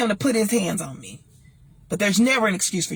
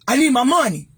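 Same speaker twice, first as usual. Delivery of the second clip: angry husband; 4 semitones higher, 5 dB louder than usual. Retold or imitated. Husband's demand retold.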